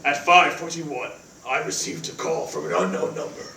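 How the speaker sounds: low hushed brooding killer voice; assassin's voice. Acted. [name] tells roommate Cody about the call in his assassin voice, low and hushed. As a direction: mutter it like a grim movie assassin. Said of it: "it's my assassin's voice".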